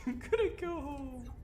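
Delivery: small, very high-pitched voice; shaken whimper. Real, not acted. Giving up after the scare.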